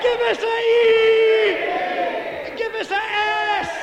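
high, drawn-out crowd chant shout; rallying spell-it-out chant; exuberant cheer leading. Retold or imitated. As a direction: call out each letter like a cheerleader, stretching it long. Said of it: "they'd get exuberant and excited".

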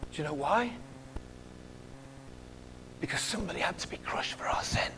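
hushed, grave whisper. Real, not acted. Preacher whispering to the congregation. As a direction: whisper it as a solemn truth. Solemn point on the cross.

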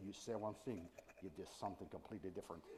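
put-on a low, soft, lightly Welsh-sounding accent; semi-Welsh accent. Imitating the French complaint in a vague Welsh accent. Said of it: "In that weird kind of semi-Welsh accent".

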